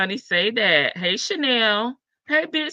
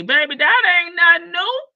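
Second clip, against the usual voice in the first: sassy scoff; 7 semitones higher, 7 dB louder than usual. Real, not acted. Scoffing at gossip.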